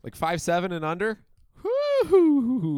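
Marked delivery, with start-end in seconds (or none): 2.0-2.8 playful whoop. Real, not acted.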